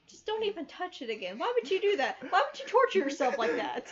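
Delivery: high, loud, incredulous scolding; loud, high-pitched comic protest; mock outrage. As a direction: exclaim in exasperated disbelief, pitch jumping up. Mock outrage at rewatching a bad movie.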